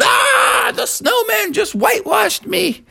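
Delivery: very high shocked shout; startled yelp. A narrator's reading. Bucky crying out after being whitewashed, shocked yelp.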